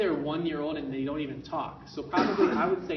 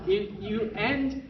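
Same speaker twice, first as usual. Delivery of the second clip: ordinary talk, nothing marked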